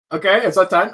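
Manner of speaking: normal talking voice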